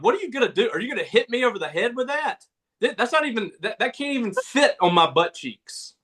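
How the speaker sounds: high-pitched, disbelieving retold protest; incredulous protest. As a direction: retell it as if baffled by the threat. Retelling his protest at dad.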